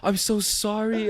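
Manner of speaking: high, slow, loud wail of apology; mock bawling. Coco apologizing while bawling, high mock wail. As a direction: imitate a tearful, drawn-out apology. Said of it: "starts bawling".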